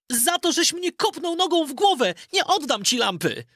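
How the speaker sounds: put-on high, boyish voice refusing; a lad standing up to his uncle, pitched high; defiant young Aladyn. Aladyn refuses the sorcerer after the kick, high defiant boy voice. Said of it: "zawołał".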